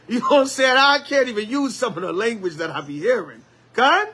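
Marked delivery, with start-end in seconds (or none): none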